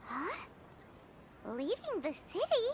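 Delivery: normal talking voice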